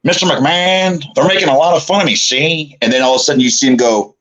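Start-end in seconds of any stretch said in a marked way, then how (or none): 0.0-2.8 high-pitched, put-on complaining voice; funny-talking character. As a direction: plead to the boss in a silly high voice. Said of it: "They say I talk funny".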